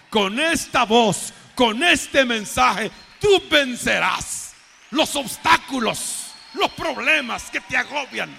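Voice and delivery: loud, slow, emphatic exhortation; full-force preaching. Acted. Preacher urging the congregation to hear God's message, full-force proclamation. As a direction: thunder each phrase out slowly to the whole congregation.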